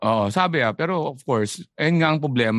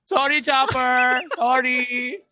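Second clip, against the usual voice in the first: mock apology; 8 semitones higher, much slower than usual. Real, not acted. Teasing apology after crying.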